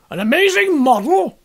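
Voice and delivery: loud, high exclaiming shout. Acted. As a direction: bellow it back at the top of your voice. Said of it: "shouted Trevor at the top of his voice".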